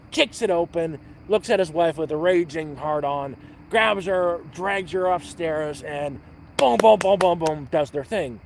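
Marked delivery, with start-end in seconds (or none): none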